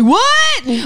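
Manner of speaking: high, drawn-out mock yell of "What?"; high-pitched incredulous outburst, mocking; imitated yell. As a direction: yell it like an outraged heckler. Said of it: "She yelled out, like".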